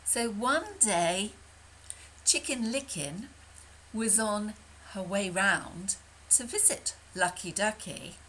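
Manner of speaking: ordinary delivery, nothing marked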